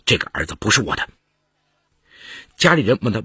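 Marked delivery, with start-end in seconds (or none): 0.0-1.1 lower, quick, certain denial; firm insistence. A narrator's reading. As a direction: state it firmly, pitched low. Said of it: "金杰再三肯定地说".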